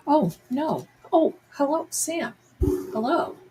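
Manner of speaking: high, lilting hello to a pet; pet-greeting voice. Real, not acted. Owner greeting Sam the cat walking through, high pet-talk voice.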